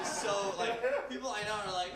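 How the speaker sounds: ordinary talk, nothing marked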